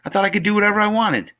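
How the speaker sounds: put-on high, fast, loud kid's protest; challenging boy. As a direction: rush it out like an indignant boy. Boy character challenges the woman.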